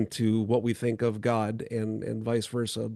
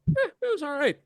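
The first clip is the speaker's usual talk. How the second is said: much higher, shrugging mock meh; mock lukewarm; 13 semitones higher, 3 dB quieter than usual. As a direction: imitate a shrugging, unimpressed guest. Quoting lukewarm guest reaction.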